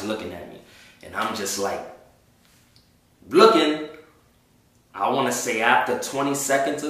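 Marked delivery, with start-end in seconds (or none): 3.3-3.9 stunned. Retold or imitated. Frozen stare at whole plane.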